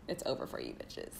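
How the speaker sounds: playful whispered jab. Real, not acted. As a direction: hush it out like a cheeky secret. Joking insult, then just kidding.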